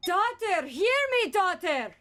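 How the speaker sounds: loud calling. Acted. Repeats demo, loud call.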